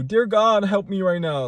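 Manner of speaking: hammed-up desperate prayer, high and loud; mock a frantic cry to God. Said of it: "I don't do".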